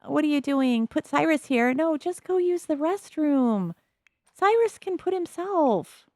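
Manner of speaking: high-pitched exasperated protest. Real, not acted. Gamer telling off a Sim for fussing over Cyrus, exasperated. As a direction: protest in a high, dragged-out whine.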